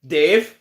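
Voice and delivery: put-on Dave said clearly for a toddler to repeat; prompting a child. Shown as a demonstration. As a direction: model the word for a baby. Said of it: "Can you say this?".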